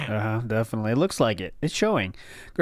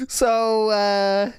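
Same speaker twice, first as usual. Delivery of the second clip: laughing; 6 semitones higher than usual. Real, not acted. Laughing at the sneeze joke.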